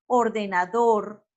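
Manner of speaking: "ordenador" slowly, landing hard on final "dor"; stress on last syllable. Shown as a demonstration. Teacher gives an example of agudas, slow "ordenador" stressed on "dor". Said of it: "la fuerza se le da a la última sílaba".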